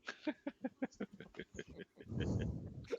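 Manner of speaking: high, soft giggling; giggle. Real, not acted. Laughs after calling a charisma check.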